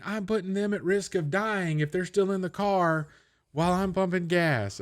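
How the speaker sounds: high, slow, prissy mock outrage; slow high-voiced faux indignation; mock outraged parent. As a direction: play a scandalized parent, pitched high and drawn out. Parody of outraged Oregonian commenter.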